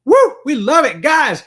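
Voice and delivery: excited cheer. Real, not acted. Cheering for the gift mug.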